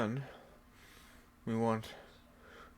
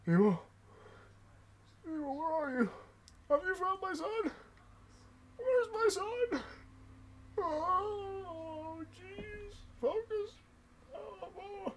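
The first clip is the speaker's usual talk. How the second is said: higher, slow, anxious calls for his son; grieving father calling; 11 semitones higher, 3 dB quieter, slower than usual. Acted. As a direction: search desperately for a lost son. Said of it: "And he just".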